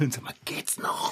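angry whisper; hissed whisper. Acted. Boy scolds the cat in an angry whisper. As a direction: scold him without being heard.

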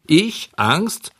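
high-pitched, incredulous echo; high, punched-out questioning; scornful disbelief. A narrator's reading. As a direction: scoff at the very idea. Defiant boy answers the Fairy.

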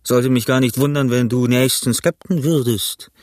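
pirate Silver, mocking praise. A narrator's reading.

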